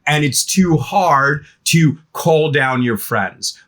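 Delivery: normal talking voice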